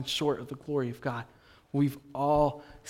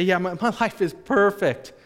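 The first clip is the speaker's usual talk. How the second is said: loud, higher-pitched pretence that all is well; mock cheerful boast; 6 semitones higher, 7 dB louder than usual. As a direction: put on the perfect-life act. Mimics people pretending all is well.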